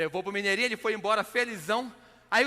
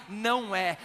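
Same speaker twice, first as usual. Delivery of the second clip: ordinary talk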